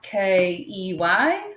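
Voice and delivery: K E Y spelled letter by letter, slowly; slow spelling. Shown as a demonstration. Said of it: "Spell it back".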